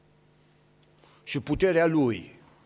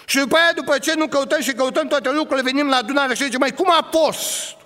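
loud, higher preaching build; fiery preaching; 10 semitones higher, 4 dB louder than usual. Acted. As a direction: drive the point home to the congregation.

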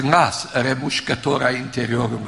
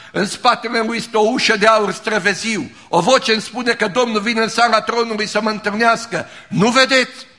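much higher and louder, lit up with wonder; ecstatic vision; 7 semitones higher, 5 dB louder than usual. Retold or imitated. Pastor quoting the dying woman's vision: high, excited, awed. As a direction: voice her rising into heaven.

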